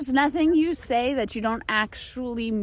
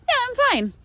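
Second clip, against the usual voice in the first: bright polite reply; 9 semitones higher than usual. Retold or imitated. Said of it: "the answer is".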